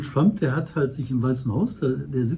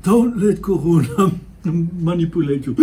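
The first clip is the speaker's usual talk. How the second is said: imitating Trump; 4 dB louder than usual. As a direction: ham up a laughing Trump. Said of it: "dann sagt er so".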